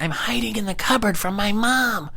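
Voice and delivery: put-on high, squeaky twelve-year-old boy; kid voice John. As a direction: play a pesky little kid on the phone. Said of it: "this twelve-year-old kid, John".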